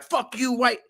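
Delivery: hammed-up angry drunk yelling back; retelling the attacker's threats, mock angry. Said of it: "he'd come over and be like".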